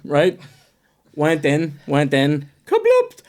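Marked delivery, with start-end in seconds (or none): 2.7-3.2 very high burst of a comic noise; comic splat word; sound effect word. Retold or imitated. Mock sound of sand bursting.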